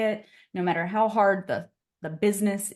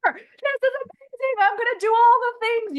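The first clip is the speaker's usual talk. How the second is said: much higher, louder giddy gush; mock conference hype; 6 dB louder than usual. Coach mocking the post-conference high, overexcited. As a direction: gush like you're fired up after a conference.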